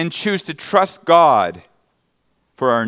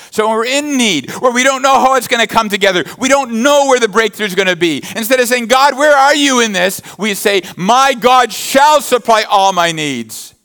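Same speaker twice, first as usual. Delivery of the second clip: fervent preaching; 8 semitones higher, 5 dB louder than usual. Acted. Preacher urging worried listeners toward faith, loud and rising. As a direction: preach it out and lean into the promise. Preacher builds to full force.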